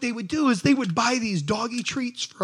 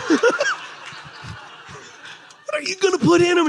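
laughing suspicion; 8 semitones higher, faster than usual. Retold or imitated.

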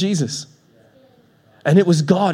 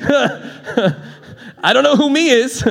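slow, laughing, higher bewildered line; 7 semitones higher, much slower than usual. Real, not acted. Preacher joking about a mystery voicemail, laughing. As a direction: laugh through the confusion.

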